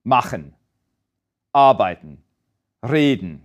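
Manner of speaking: first syllable stressed in Machen, arbeiten, reden, the ending let drop. Shown as a demonstration. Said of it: "The stress here is on the first syllable".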